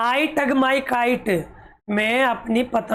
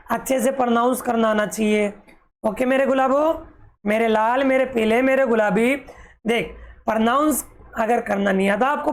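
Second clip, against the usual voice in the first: ordinary delivery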